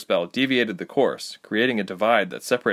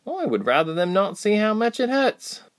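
Reshma's voice; 12 semitones higher than usual. Acted. Reshma voiced, guarded confession.

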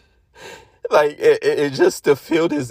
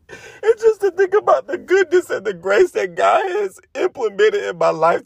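high, tear-choked voice; crying through gratitude; tearful. Real, not acted. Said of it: "I'm getting really emotional right now".

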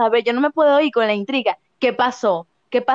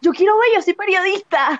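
higher, insistent and nosy; eager; 6 semitones higher than usual. Retold or imitated. Bella insisting on watching the fight, mildly higher. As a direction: insist like a stubborn reporter. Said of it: "Y yo".

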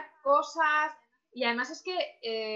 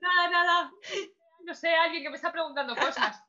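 mock confusion, laughing and louder; 5 semitones higher, 5 dB louder than usual. Guest pretends someone unseen questions her, laughing. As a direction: pretend to hear voices, giggling. Joking about hearing voices.

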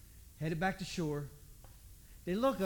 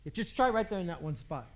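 ordinary talk

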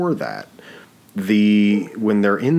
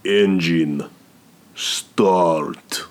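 put-on better Russian accent; much slower than usual. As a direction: do the Russian mobster accent properly. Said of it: "Yeah, way better".

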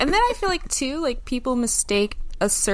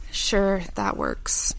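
sighing, clipped "Sure, that works" fading to breath; resigned fake agreement; passive-aggressive; much slower than usual. Shown as a demonstration. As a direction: sigh then grudging sure. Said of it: "A passive-aggressive response".

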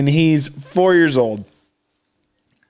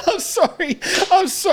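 high, squeaky apology through laughter; real giggling apology; helpless laughing; 13 semitones higher than usual. Real, not acted. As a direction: say sorry while cracking up.

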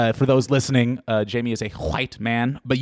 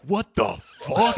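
mock outrage; 7 semitones higher, 3 dB quieter, slower than usual. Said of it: "I'm gonna be like".

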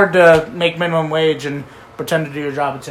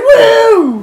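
high, loud whoop; cheer; 8 dB louder than usual. Real, not acted. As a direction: let out a big excited whoop. Cheering the throw.

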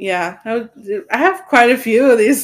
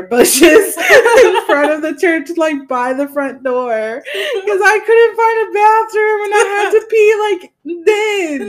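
higher voice, laughing through the words; laughing confession; 10 semitones higher, 5 dB louder than usual. Real, not acted. Telling sister about peeing in church bushes, laughing. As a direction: confess it while cracking up.

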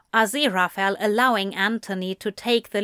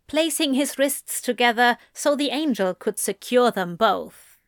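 ordinary delivery

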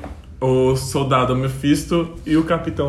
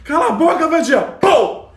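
mock yell; 10 semitones higher than usual. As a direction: shout her down, fed up. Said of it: "E o Érico assim". (grito irritado mandando calar a boca)

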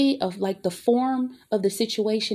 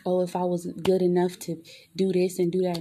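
usual speaking voice

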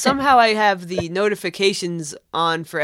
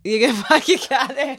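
put-on high Boston accent with a laugh; 7 semitones higher than usual. Host playing along in the accent bit, laughing Boston accent. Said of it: "I'll put on my Boston accent".

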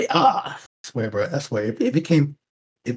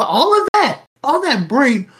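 higher, louder scornful jab; mocking disbelief; 9 semitones higher, 4 dB louder than usual. Real, not acted. Host mocking the woman's thinking, scornful. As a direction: sneer at her brain power. Mocking her brain power.

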